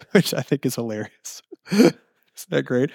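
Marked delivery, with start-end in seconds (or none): none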